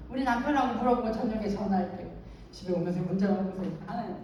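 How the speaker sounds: ordinary talk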